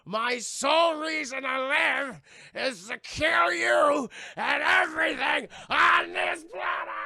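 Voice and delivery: freaking-out villain shouting, high and slow; Zod. Acted. Zod threatening to kill everything, full-force raging shout. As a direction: roar the threat, stretching every word. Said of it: "he just fucking freaks out".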